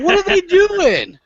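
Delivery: high, drawn-out plea of disbelief; incredulous, stretched-out rant; exasperated. Real, not acted. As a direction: wail it slowly in exasperation. Ranting at the developers' choices.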